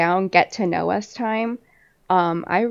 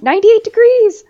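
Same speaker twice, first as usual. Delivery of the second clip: high, loud shocked exclamation; 13 semitones higher, 10 dB louder than usual. Real, not acted. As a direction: gasp out the number in disbelief. Shocked at the heat.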